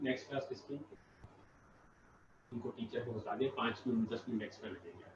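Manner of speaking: very soft and low; quiet aside. Real, not acted. Teacher arranges extra minutes off to the side, very quietly. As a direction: drop the voice right down for an aside. Aside about the next class.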